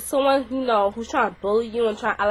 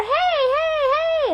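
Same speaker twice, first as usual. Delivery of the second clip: mock dazed blind girl; much slower than usual. Half-blind girl after eye poke.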